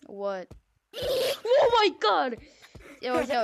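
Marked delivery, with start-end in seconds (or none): 1.0-2.4 loud, drawn-out gasp of surprise; shocked exclamation. Real, not acted. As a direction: react big to the surprise. Jump scare reaction.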